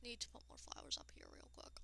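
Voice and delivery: whispered quick aside; whispering. Real, not acted. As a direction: mutter it under your breath. Quiet aside while building.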